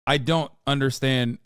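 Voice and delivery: ordinary talk, nothing marked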